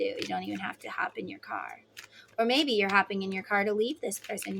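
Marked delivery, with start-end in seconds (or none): 0.0-1.8 whispered aside, much softer and lower. Real, not acted. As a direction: let them in on a secret, whispering. Aside to viewer.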